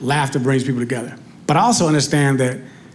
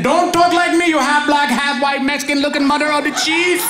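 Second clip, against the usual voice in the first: put-on angry Indian accent; 10 semitones higher than usual. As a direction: yell back at the caller in an Indian accent. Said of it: "He got upset".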